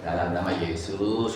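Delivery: low, soft, simple prayer; calm and understated; restrained invocation. Shown as a demonstration. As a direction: say it quietly, with no strain. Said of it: "gitu aja udah cukup".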